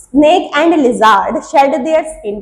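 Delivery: very slow and clear, word by word; slow dictation. Shown as a demonstration. Teacher dictating to students, slow and deliberate. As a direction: spell out each English word slowly for notes. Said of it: "देखो".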